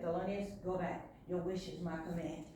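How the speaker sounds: low, hushed granting voice; soft wish-granting. Acted. Princess Fish sending Thelonious home, soft and low. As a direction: drop right down, soft and low.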